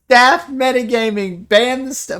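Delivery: high, loud playful accusation; mock outcry. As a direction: jokingly cry foul, pitched well up. Joking mock outcry at staff.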